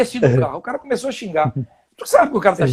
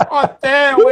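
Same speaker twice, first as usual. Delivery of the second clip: excited hotel; 5 semitones higher, 5 dB louder than usual. Retold or imitated. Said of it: "eu falei".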